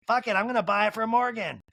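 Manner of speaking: high mock impersonation; Kyle. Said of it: "Kyle's like".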